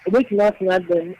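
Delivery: normal talking voice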